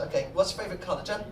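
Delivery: normal talking voice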